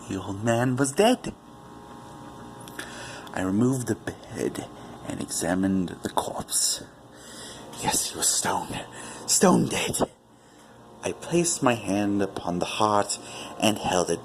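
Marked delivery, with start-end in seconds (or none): none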